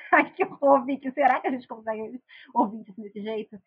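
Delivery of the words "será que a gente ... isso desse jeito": ordinary talk, nothing marked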